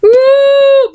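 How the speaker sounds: loud high howl, drawn out; high frustrated howl. Real, not acted. Gamer howls when the mouse fails. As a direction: let out a high wail of annoyance.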